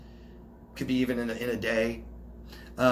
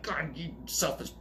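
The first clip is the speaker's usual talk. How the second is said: slow, softer mock scorn under the breath; mock disgust; 2 semitones higher, 6 dB quieter, slower than usual. Host imitating their old hypervigilant reaction, mock disgust. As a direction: act out a muttered judgment.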